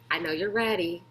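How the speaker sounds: ordinary delivery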